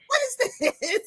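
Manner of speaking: high-pitched mock bewilderment; baffled amazement. Host acting out finding a surprise box, baffled. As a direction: act out puzzled wonder, voice way up.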